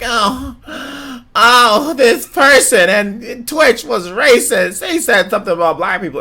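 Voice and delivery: high, drawn-out fake sobbing whine; mock crying complaint. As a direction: wail in hammed-up distress, high and loud. Mimicking upset reaction to racism.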